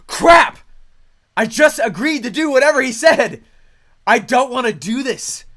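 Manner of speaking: loud, high yelp of dismay; panicked outcry. Retold or imitated. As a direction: burst out in loud alarm, then grumble. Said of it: "And I was like".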